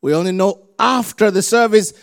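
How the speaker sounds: loud, driving preacher voice; preaching. Acted. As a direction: preach it with full force. Preaching at full force.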